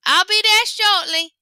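high, loud, slow call; calling out to an imaginary caller, high and slow. Acted. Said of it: "called out".

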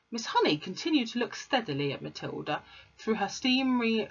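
ordinary delivery, nothing marked

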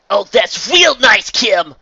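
loud, high, slow sarcasm; mock praise thrown at Kim; sarcastic jab. A narrator's reading. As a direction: drip with bitter sarcasm. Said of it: "she sighed".

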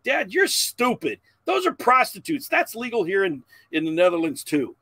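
high, loud, indignant know-it-all son; imitated teen shouting. Oldest son correcting his dad in public, imitated indignant shout. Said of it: "He shouts it out".

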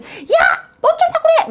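high, loud alarmed yell; frightened shout. Retold or imitated. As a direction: cry out in shock at a child in danger. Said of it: "놀래서 소리 지르시잖아요".

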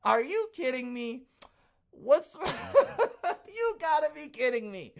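rising to a high, loud laughing cry of disbelief; outraged shock building into laughter. Retold or imitated. Reacting to the card news, shocked and laughing. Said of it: "I was shocked when I saw this".

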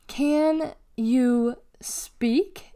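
exaggeratedly drawn-out mocking question; mocking slow question. A narrator's reading. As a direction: taunt as if talking to someone dim. Boy mocking Deli as slow.

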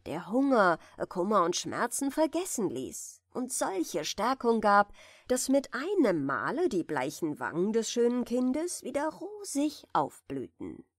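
usual speaking voice

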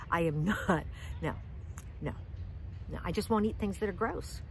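usual speaking voice

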